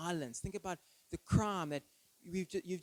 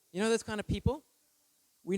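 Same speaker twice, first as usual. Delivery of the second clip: normal talking voice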